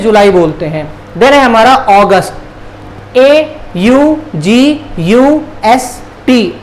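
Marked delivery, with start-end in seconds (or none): 3.2-6.6 slow, letter by letter; spelling out. Shown as a demonstration. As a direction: spell it out slowly with a gap after each letter. Spelling the month for learners. (एक-एक अक्षर धीरे-धीरे)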